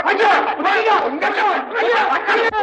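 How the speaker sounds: panicked wail. Acted. Comic panic.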